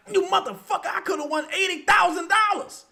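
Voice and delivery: high, loud mock rant at a lost parlay; mock furious bettor. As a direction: play the bettor who just lost it all, yelling. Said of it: "you be mad as a motherfucker".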